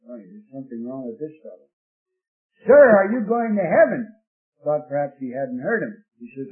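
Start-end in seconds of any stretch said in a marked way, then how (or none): 0.0-1.7 low, soft, quick aside; muttered aside. Retold or imitated. Tract distributor thinking the man odd, muttered aside. Tract man's puzzled private thought. 2.7-4.1 much louder, higher call, hard on Sir; louder repeated yell. Retold or imitated. Tract distributor thinking the man didn't hear, yelling louder. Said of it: "And he yelled".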